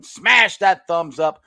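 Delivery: loud, slow push to smash like; hyped call to action. Real, not acted. Host urging viewers to smash the like button. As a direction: punch it out to the viewers. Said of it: "urging viewers to like".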